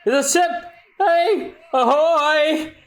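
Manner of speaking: high, shouted hail across water; calling out. Acted. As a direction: call out to the ship. Calling out to a ship.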